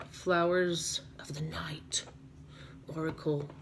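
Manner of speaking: put-on slow, hushed English accent. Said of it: "I'm talking in an English accent".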